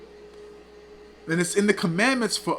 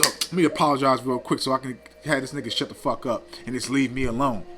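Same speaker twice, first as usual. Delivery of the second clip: lower-pitched imitation of private grumbling; mock insincere aside; 5 semitones lower, 3 dB quieter than usual. Preacher voicing a fake apologizer's thoughts, low mocking aside. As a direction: drop the pitch as if thinking it to yourself, dismissively.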